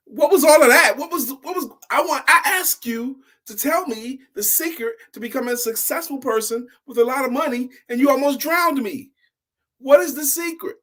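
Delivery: put-on high, loud, indignant child voice; upset boy. Boy confronting the old man, high loud outrage. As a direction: demand answers, shaken and outraged. Boy demanding after nearly drowning.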